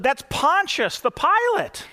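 put-on proud little girl explaining; little girl answering. Girl quoted in joke story.

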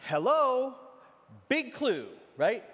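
loud, high, sarcastic hello; Jesus needling the leaders, loud and sarcastic; mock exasperation. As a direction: ham up an obvious hint to the leaders. Jesus mocks the religious leaders.

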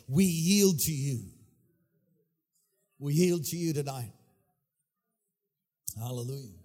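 low, reverent prayer voice; prayerful invitation. Real, not acted. Preacher asking the Holy Spirit to have his way, prayerful. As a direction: lead the room gently into prayer, pitched well down.

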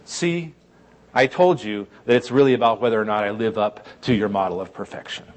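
low, smug undertone; pious smugness, pitched low; self-righteous. Acted. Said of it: "with our self-righteous penchant to say".

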